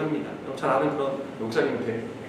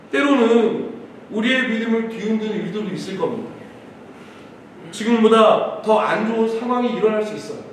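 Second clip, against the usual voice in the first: raised, measured preaching voice; emphatic preaching; 5 semitones higher, 4 dB louder than usual. Real, not acted. Pastor urging the congregation to trust God's leading, emphatic preaching. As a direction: preach with conviction, phrase by phrase.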